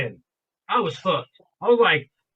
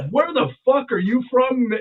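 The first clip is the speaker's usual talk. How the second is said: loud, higher-pitched mock astonishment; incredulous; 5 semitones higher, 5 dB louder than usual. Diner questioning the stranger about his origins, retold incredulous outburst. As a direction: demand it in baffled disbelief.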